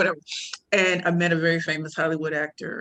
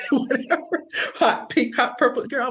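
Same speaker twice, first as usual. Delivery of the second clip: higher, laughing through words; 7 semitones higher, 3 dB louder than usual. Real, not acted. Laughing at her loud self.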